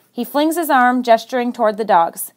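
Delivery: ordinary delivery, nothing marked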